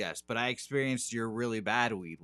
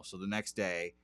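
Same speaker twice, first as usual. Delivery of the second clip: ordinary talk, nothing marked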